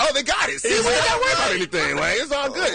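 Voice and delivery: high, fast, laughing delight; excited. Retold or imitated. To his friends at the drive-thru, high laughing delight. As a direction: squeal with glee that they got it. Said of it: "I was like".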